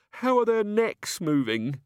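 high, slow, frightened quaver. A narrator's reading. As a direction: tremble out the question in dread. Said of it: "quavered Chicken Wire".

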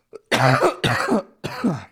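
loud hacking cough; harsh cough. Real, not acted. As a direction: cough hard into the mic. Coughing fit after a dab.

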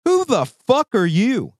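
high, loud indignant demand; pretend-furious challenge to the stranger; mock outrage. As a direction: confront them like they overstepped. Ranting at imagined person.

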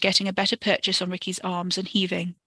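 ordinary delivery

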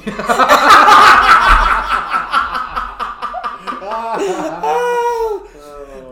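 loud crying laughter; laughing fit. Real, not acted. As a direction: laugh until it hurts.

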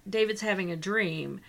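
ordinary talk

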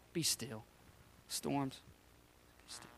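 very soft, low command with pauses; low, unhurried near-whisper; hushed command. Acted. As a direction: quietly order the storm to settle. Acts out calming the storm.

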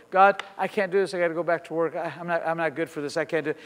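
usual speaking voice

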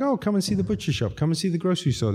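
high-pitched, sing-along welcoming patter; acted chirpy hosting; eager tour guide. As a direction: usher a guest round with showy enthusiasm.

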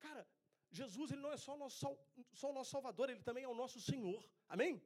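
fervent preacher building up; preaching at full force. Acted. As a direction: drive the point home to the church. Preaching to the congregation.

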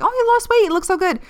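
high, sing-song fake compliment; sugary imitation of someone praising weight loss; mock compliment. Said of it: "when someone's like".

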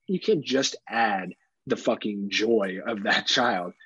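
ordinary talk

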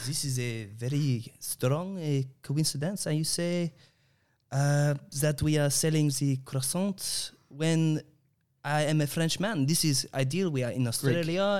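hesitant put-on French accent. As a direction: play a Frenchman, feeling for words.

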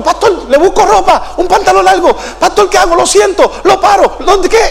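high-pitched, frantic string of offers; panicky Alfredo. Retold or imitated. Alfredo desperate to help his crying pastor, imitated high and panicky. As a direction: imitate a flustered helper, jumping from idea to idea. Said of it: "Y Alfredo me decía".

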